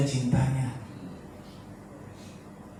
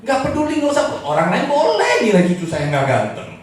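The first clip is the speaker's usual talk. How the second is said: very high, loud impassioned sermon; full-force preaching; 10 dB louder than usual. Real, not acted. Preacher on God seeing you as he sees his grandson, impassioned. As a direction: cry it out to the whole room.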